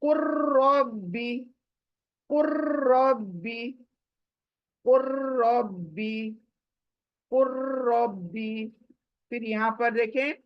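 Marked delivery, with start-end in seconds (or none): 0.0-8.7 कुर्रबी recited slowly with the रॉ doubled; slow recitation. Shown as a demonstration. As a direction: stretch कुर्रबी, jumping from कू to रॉ. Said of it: "कू से रॉ पर jump होगी".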